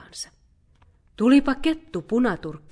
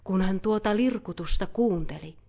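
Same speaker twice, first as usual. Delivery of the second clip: plain reading voice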